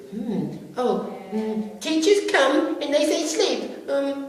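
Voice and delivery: put-on high, slow, musing child voice; thoughtful kid. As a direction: think it over like a little know-it-all. The clever boy pondering.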